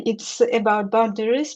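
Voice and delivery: usual speaking voice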